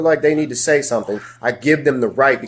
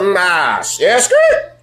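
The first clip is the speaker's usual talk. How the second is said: high, imitated tire screech; abrupt objection; 6 semitones higher, 3 dB louder, much slower than usual. Stopping someone who talks down to him, high screeching halt. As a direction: cut in with a high skidding-brakes noise. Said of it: "I'm gonna let you know".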